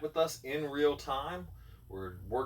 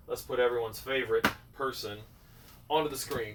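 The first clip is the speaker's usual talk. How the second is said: ordinary talk, nothing marked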